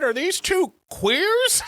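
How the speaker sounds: slow, high, puzzled drawl; mock Jimmy Stewart. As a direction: play dumb like an old movie star. Jimmy Stewart quoted asking.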